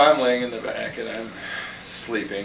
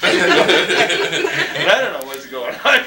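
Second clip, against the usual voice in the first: laughing; 8 semitones higher, 6 dB louder, much slower than usual. Real, not acted. Laughing while telling story.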